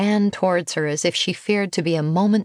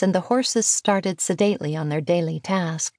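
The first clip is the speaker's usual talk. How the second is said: plain reading voice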